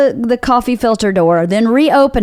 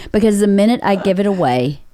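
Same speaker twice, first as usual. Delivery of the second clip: usual speaking voice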